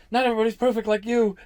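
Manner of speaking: loud, high, scornful jab with a laugh; fiery sarcastic retort. Retold or imitated. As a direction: throw it back at your brother, heated. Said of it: "full of fire".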